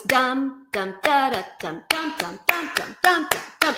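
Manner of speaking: DUM syllables punched in rhythmic groups; emphasized rhythm. Shown as a demonstration. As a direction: sound out each dum as a beat, accenting the groups. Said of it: "you really have to emphasize".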